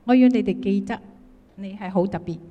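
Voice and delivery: ordinary delivery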